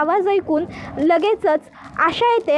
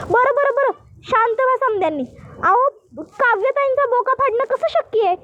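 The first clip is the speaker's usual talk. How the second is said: higher, anxious rustic maid asking what happened; village maid voice; 6 semitones higher than usual. Acted. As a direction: play the worried housemaid in dialect. Asha calming everyone.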